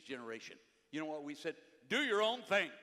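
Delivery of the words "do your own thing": high, loud sixties chant; loud mock rallying cry; mock slogan shout. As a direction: call it out like a hippie slogan. Preacher voicing sixties slogan loudly.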